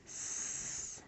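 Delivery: "S" as a soft hissed s sound with no voice; letter sound. Shown as a demonstration. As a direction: hiss the s sound quietly. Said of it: "S has to be capitalized".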